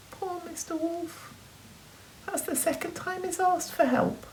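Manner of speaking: sympathetic. A narrator's reading. Sympathy for the wolf.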